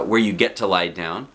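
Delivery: ordinary delivery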